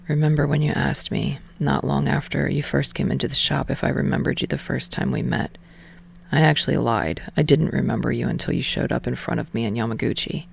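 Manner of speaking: put-on a low, quiet confession to his boyfriend; Tsukishima's voice. A narrator's reading.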